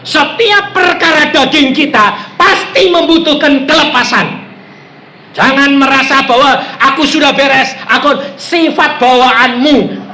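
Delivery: fervent sermon delivery; forceful preaching. Real, not acted. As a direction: drive it home to the congregation.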